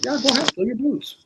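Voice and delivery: high, carefree go-ahead; breezy dismissal. Retold or imitated. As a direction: wave him off lightly. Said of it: "if an apprentice says to me".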